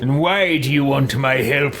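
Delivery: put-on character voice, questioning; theatrical, affected questioning; haughty game hero. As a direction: play a self-important hero asking for reasons. Player voices his character.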